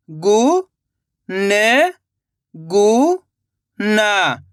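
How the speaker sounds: रु-का-व-ट spoken one slow drawn-out syllable at a time. Shown as a demonstration. Teacher sounding out a word for children, syllable by syllable. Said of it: "teacher sounding out syllables".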